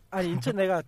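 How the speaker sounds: normal talking voice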